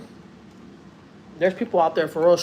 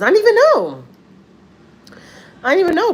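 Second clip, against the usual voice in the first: amazed exclaim; 13 semitones higher, 8 dB louder than usual. Real, not acted. Marvels at overheard advice.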